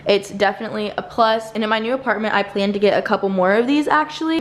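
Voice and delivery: ordinary talk, nothing marked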